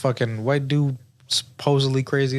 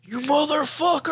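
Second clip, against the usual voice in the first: much higher, mock outraged yell. Real, not acted. Friend reacting to the twist, mock outraged yell. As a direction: fling it out in mock fury.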